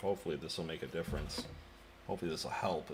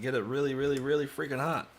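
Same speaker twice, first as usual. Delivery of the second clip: excited; 7 semitones higher, 8 dB louder than usual. Real, not acted. Pushing the iron hotter.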